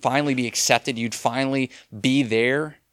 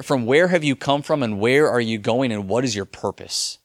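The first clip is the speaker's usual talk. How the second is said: normal talking voice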